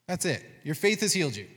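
ordinary talk, nothing marked

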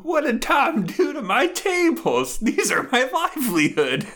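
high, slow, anguished wail about her tables; distraught sketch character. Retold or imitated. To a friend, quoting a sketch character in high anguish. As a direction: mourn the tables like a ruined livelihood.

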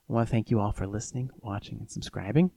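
hushed sign-off, dog sleeping; soft sign-off so the dog sleeps; quiet at night. Real, not acted. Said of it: "let's go ahead and whisper out of this one".

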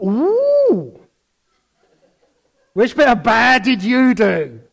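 high teasing ooh, then eager prying question; mock nosy interest. Preacher teases a baptism testimony, high cheeky ooh. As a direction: lean in, gossip-hungry and cheeky. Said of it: "And I went".

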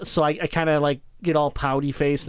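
ordinary talk, nothing marked

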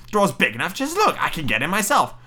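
high, swooping witch voice; old witch. Acted. Witch demonstrating the oven to Gretel, high swooping voice. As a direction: show her impatiently, voice leaping up. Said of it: "said the old woman".

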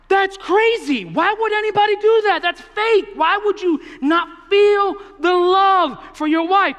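high, loud mock outrage from the students; outraged students. Students scoffing at the leaders, mock outrage. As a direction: squeal it like shocked teenagers. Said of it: "they were like".

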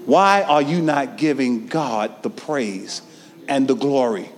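ordinary talk

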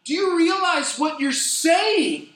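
high, loud, slow pleading cry; preacher's impassioned plea. Retold or imitated. Francis Chan confronting the conference about prayer, impassioned. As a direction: plead with the crowd like a fiery preacher. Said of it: "He says".

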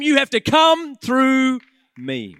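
you have to come through me, loud and slow; booming pulpit declaration; loud proclamation. Acted. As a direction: declare it as Jesus defending them. Jesus quoted at preaching climax.

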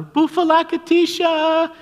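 put-on very high, loud, drawn-out little-girl squeal. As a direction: yell it slowly at the very top of the voice. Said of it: "would yell a little louder".

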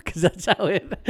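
usual speaking voice

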